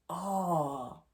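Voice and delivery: a real tearful "Oh." breaking into a cry. Real, not acted. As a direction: let the cry catch the word. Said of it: "I don't know if you guys can hear that".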